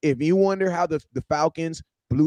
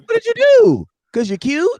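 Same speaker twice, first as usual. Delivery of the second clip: a much higher, louder mocking question; mock them for being famous for nothing; 8 dB louder than usual. Mocking Instagram chicks.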